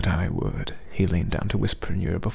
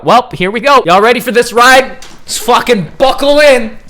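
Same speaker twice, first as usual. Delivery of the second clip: hyped shout; 14 dB louder than usual. Real, not acted. Hyped yell bracing for scene.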